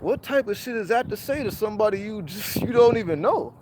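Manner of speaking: baffled and offended at the stranger; indignant disbelief building up; incredulous. Retold or imitated.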